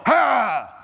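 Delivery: high imitated dog snarl; money growling like dog. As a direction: snarl like a snappy little dog. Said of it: "your money may go".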